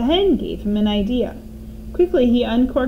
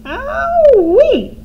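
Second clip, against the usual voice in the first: high piercing hen screech; squawking hen cry; hen's screech; 9 dB louder than usual. A narrator's reading. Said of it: "screeched the hen".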